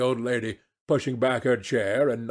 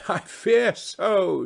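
higher, slow words opened by a laugh; 8 semitones higher, slower than usual. A narrator's reading. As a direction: give a laugh, then draw out the reply.

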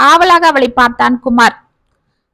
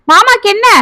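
sharp, much higher demand; alarmed; 9 semitones higher, 3 dB louder than usual. Acted. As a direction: panic at what he did. Mother alarmed at uncle mention.

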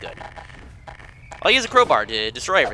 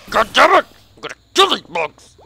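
angry cursing; 8 semitones higher, 5 dB louder than usual. Real, not acted. Cursing at bugs.

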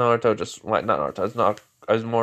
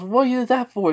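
high indignant Sakura; 14 semitones higher than usual. Pretend. Sakura scolds Mori for throwing the kunai, high indignant voice. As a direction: snap at him like an annoyed girl.

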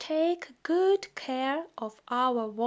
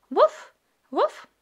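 high, loud puppy woof; puppy bark; 9 semitones higher, 6 dB louder than usual. Acted. Voicing the puppy Biscuit barking.

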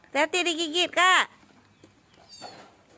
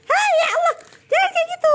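alarmed shout; 5 dB louder, faster than usual. Real, not acted. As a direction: shout out in alarm as the kitten is squeezed. Parent cries out at child.

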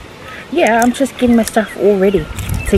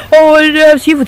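loud, raised mocking quote; mocking imitation; 6 semitones higher, 8 dB louder than usual. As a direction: mimic her, teasing. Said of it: "You told me".

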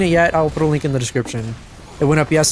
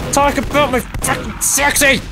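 high, strained exasperated cry; frustrated outburst; 9 semitones higher than usual. Real, not acted. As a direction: blurt it out while flustered. Frustrated while getting killed.

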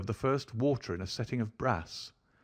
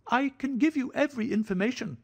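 much higher, anxious fussing; a hypochondriac begging for reassurance; fretful thin high voice; 4 dB louder than usual. A narrator's reading. Nervous host pleading with the doctor about his heart, thin and high. Sholto speaks.

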